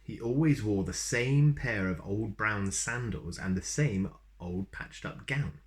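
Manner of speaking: usual speaking voice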